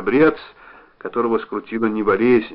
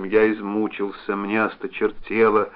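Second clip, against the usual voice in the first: plain reading voice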